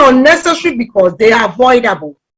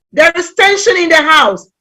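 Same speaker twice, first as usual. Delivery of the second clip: ordinary talk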